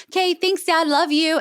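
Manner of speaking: light, high-pitched imitation of a daughter; affectionate daughter. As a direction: toss off a high, casual thanks to Dad. Imitates friend's casual affection to dad.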